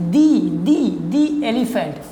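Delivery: very slow, repeated "dee" for "the" before "elephant". Shown as a demonstration. Teacher drilling the example before a vowel, "the" pronounced दी. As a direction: draw out each "the" with a long "ee". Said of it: "हम इसको दी बोलेंगे".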